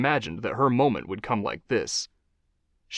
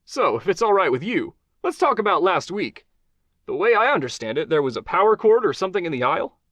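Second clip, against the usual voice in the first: higher, upbeat host voice; talk-show host Johnny; 8 semitones higher, 4 dB louder than usual. Acted. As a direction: charm the guest as a TV host. Host asks question.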